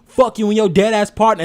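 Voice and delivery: loud, high yelled rap line; mock scream. Retelling a rapper screaming the line, loud mock yell. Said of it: "he literally screams".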